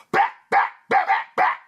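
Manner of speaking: sharp percussive bursts without pitch; popping mouth gunfire; imitated gunshots. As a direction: fire off shots with the mouth.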